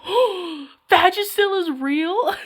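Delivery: mock shocked discovery; gasping surprise. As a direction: blurt it out amazed in the store.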